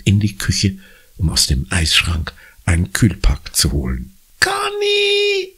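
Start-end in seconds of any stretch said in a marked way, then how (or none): 4.4-5.5 put-on wailing kid. A narrator's reading. Bleeding little brother calls his sister, wailing. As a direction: call out for help, whining. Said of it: "jammert Jakob".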